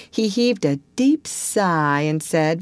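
plain reading voice

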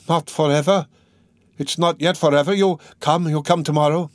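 a mother's urgent, high-voiced appeal; anxious pleading. A narrator's reading. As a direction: plead anxiously for a promise to return. Mother pleads as son leaves.

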